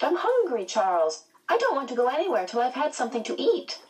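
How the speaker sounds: high-pitched, hurried grumble; cross girl refusing, pitched well up; irritable complaint, rushing at the end. A narrator's reading. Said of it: "her voice sounded cross".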